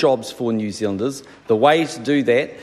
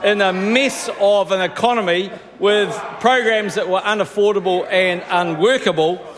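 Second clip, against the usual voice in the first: raised, shouting voice; 6 semitones higher than usual. Real, not acted. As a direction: shout over the noisy House. PM shouting over the House.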